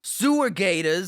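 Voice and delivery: high, slow mock Jersey Guido; Jersey Guido accent. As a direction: stretch it out in cartoon Jersey. Jersey Guido bit returns.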